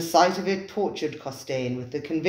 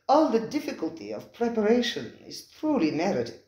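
effusive host; 8 semitones higher, 3 dB louder than usual. Acted. Spiro the restaurateur welcoming his diners, high and effusive. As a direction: gush over the guests like a fawning host.

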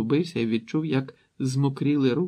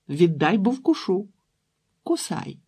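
put-on high-pitched childish threat and dare; squabbling little girls; 6 semitones higher, 4 dB louder than usual. A narrator's reading. Little sisters fight over a ball, childish squabbling. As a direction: quarrel like small sisters.

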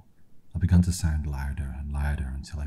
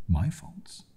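high rising disbelieving question; incredulous; 5 semitones higher than usual. Acted. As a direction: throw the word back in disbelief. Incredulous echo of accusation.

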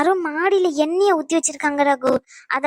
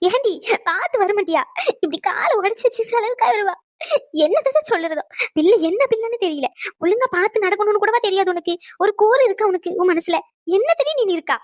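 higher-pitched, upset fretting; tearful scolding; 4 semitones higher than usual. Acted. Elder scolding injured woman for carelessness, tearful. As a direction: scold her through worried tears. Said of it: "நீ அழுகிறத பார்த்துட்டு".

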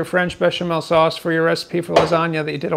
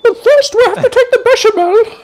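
high, loud Julia Child impression; 8 dB louder than usual. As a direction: trill it like Julia Child on her cooking show. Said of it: "You sound just like Julia Child".

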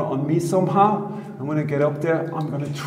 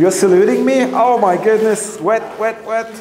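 excited shouting; 7 semitones higher, 6 dB louder than usual. Real, not acted. Wading in, reacting to water.

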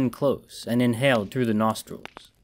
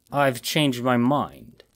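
ordinary delivery, nothing marked

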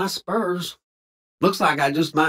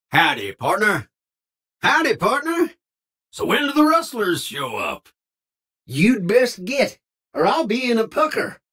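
put-on folksy Old West cowpoke voice, drawled twang; old cowboy. Game cowboy barking lines at strangers and outlaws, folksy twang. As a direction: play a grizzled frontier cowboy talking.

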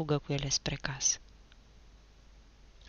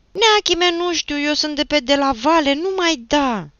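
high, loud panicked cry; shrill, hurt boy wailing; howling plea; 10 semitones higher, 10 dB louder than usual. A narrator's reading. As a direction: beg him at full voice to stop hitting. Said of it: "Cel lovit urlă".

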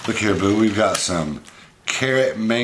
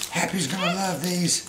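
put-on baby talk; 9 semitones higher, 5 dB quieter than usual.

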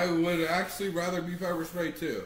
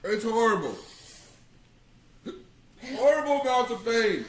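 higher and louder, in real pain; loud pained complaint; 7 semitones higher, 6 dB louder than usual. Real, not acted. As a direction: cry out in pain. Burning from the hot pepper.

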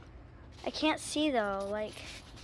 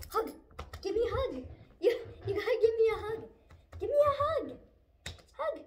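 squeaky coaxing a dog; 7 semitones higher, 4 dB quieter, faster than usual. Real, not acted. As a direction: coo at the dog for a hug.

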